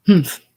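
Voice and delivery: short sharp humph of disdain; a huffy nasal grunt; scornful humph. A narrator's reading. Wife huffs at her husband, scornful humph. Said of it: "she returned".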